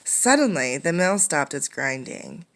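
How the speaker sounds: ordinary delivery, nothing marked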